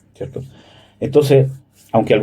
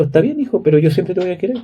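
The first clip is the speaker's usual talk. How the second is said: tender father; 5 semitones higher, faster than usual. Retold or imitated. Father answers son's hurtful words. (tierno, agudo y suave)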